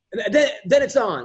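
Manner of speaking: normal talking voice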